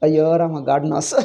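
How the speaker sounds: ordinary delivery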